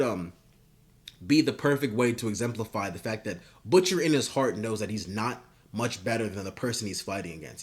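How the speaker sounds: ordinary talk, nothing marked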